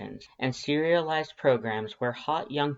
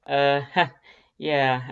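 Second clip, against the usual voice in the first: awkward laugh; 2 semitones higher, 6 dB louder, slower than usual. Acted. Awkward laugh after compliment.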